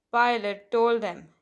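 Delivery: plain reading voice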